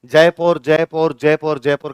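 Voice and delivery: जयपुर repeated as "jae-pore", easing off from loud; anglicised जयपुर over and over; English mispronunciation. Shown as a demonstration. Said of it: "वो इसको जयपुर बोलते".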